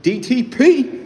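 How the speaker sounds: high, loud call of "D T P"; loud high shout; shouted tag. Real, not acted. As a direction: belt it out like a hype call.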